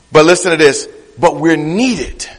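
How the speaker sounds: ordinary talk, nothing marked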